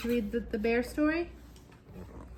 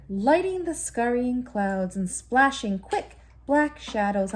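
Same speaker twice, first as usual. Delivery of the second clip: story narration; 7 dB louder, slower than usual. A narrator's reading. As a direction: tell it like a bedtime story to a child. Bedtime story narration continues.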